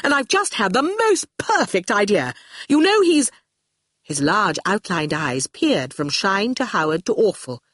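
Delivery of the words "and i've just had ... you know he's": high, gushing eagerness; breathless excitement. Acted. Torquil has the perfect idea, breathless with excitement. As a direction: gush the idea, breaking off suddenly. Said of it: "breathless with excitement".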